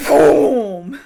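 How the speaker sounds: loud, drawn-out vocal boom; imitated explosion. Podcaster imitating the grenade going off, booming. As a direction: boom it out like a blast.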